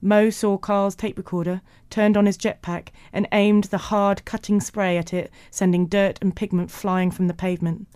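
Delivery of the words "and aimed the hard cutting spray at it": plain reading voice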